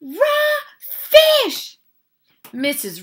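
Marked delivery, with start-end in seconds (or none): 0.0-1.8 very high, loud shriek of disgust; screaming. A narrator's reading. Guest discovers what she has bitten, screaming. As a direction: shriek it in revulsion, building on the repeat. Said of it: "she screamed".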